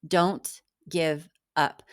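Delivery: normal talking voice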